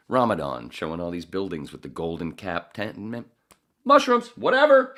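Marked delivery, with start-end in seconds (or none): 3.9-4.9 высокий громкий выкрик; выкрикни с показным раздражением; mock outburst. Sudden loud outburst.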